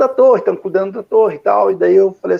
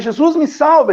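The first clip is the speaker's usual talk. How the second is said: higher, louder mock cry for help; louder retold shout; 6 semitones higher, slower than usual. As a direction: re-enact the second, louder plea. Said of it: "gritei a segunda vez mais alto ainda". (grito mais alto, relembrado)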